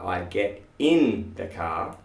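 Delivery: usual speaking voice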